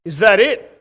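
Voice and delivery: lightly voiced cheeky schoolkid; smart-mouthed kid. Kid in class after the Queen drove past, lightly mimicked smart mouth. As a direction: imitate an unimpressed kid, lightly. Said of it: "another smart-mouthed kid in my class saying".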